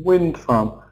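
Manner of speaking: "wind farm" with WIND stressed, farm falling away; compound noun stress. Shown as a demonstration. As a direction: hit WIND, let "farm" drop. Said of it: "we're stressing the first syllable".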